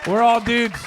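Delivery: loud, high rallying call. Acted. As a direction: shout it to the crowd. Rallying the audience, calling out.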